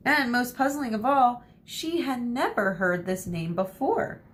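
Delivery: plain reading voice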